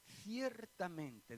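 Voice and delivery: "Ciertamente" drawn out very slowly and falling; slow, weighty "Ciertamente" as Jesus' emphasis; slow emphatic ciertamente. Shown as a demonstration. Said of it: "es otra forma en la que Jesús remacha, recalca".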